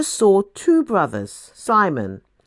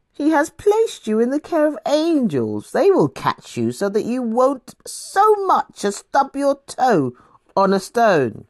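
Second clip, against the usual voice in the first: higher, sing-song mock quoting; mocking devil; 7 semitones higher than usual. The devil goading Jesus with a psalm, higher teasing mock. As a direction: quote scripture back in a teasing higher voice.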